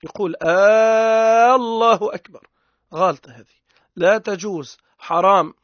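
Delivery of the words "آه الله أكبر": "آه، الله أكبر" with the opening hamza stretched into a long loud "آه"; "آه، الله أكبر" said with a lengthened opening glottal "aaah"; drawn-out first hamza. Shown as a demonstration. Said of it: "الهمزة الأولانية يطول فيها".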